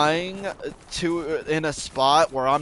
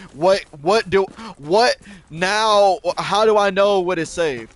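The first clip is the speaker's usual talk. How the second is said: louder and higher, throwing up his hands; exasperated outburst; 4 semitones higher, 6 dB louder than usual. Retold or imitated. Complaining about dying while thinking he was safe, a loud exasperated outburst. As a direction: vent it like it's totally unfair.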